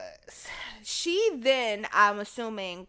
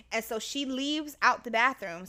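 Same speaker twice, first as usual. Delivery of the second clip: normal talking voice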